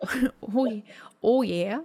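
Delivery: a whispered, mocking Fargo-accent "Oh, yeah?". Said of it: "my favorite was the".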